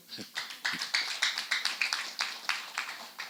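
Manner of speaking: laughing. Real, not acted. Relieved laugh after the oath.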